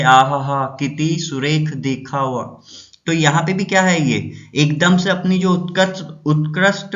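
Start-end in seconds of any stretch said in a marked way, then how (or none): 0.0-2.6 slow, drawn-out wonder; admiring exclamation. Shown as a demonstration. Teacher acting out an exclamation example for students, slow admiring wonder. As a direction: savour it like something lovely. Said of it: "जैसे".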